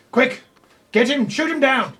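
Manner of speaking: high, loud command to shoot; rally the guards with a yell; shouted order. Acted. NPC orders the attack.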